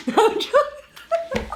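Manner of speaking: high, slow, laughing. Real, not acted. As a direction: laugh the words out. Laughs then repeats plainly.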